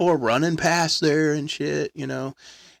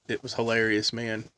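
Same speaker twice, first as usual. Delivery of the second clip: ordinary talk, nothing marked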